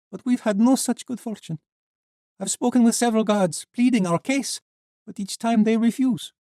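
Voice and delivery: put-on high, light young woman. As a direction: voice her bright and girlish. Sheva tells of their wait.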